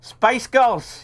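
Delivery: high-pitched put-on Scottish; Scottish accent. Shown as a demonstration. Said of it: "say Spice Girls in a Scottish accent".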